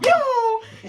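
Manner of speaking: high, loud whoosh cry; imitated zooming off. Showing how the girl took off, high loud zoom. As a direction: shoot it out like someone bolting.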